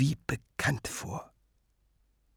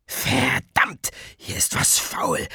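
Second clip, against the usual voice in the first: rauhes Flüstern ins Ohr; geflüstert; 6 semitones higher, slower than usual. A narrator's reading. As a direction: zischend flüstern, wie ein Windstoß. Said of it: "rauschten wie eine Windböe in sein Ohr". (leises Flüstern)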